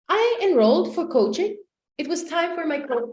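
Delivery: ordinary talk, nothing marked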